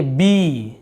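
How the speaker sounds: बी with a long drawn-out "ee"; stretched model syllable बी, falling at the end. Shown as a demonstration. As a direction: hold the vowel in बी slowly. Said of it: "जैसे बड़ी की मात्रा बोलते हैं ना ई".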